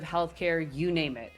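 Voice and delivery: usual speaking voice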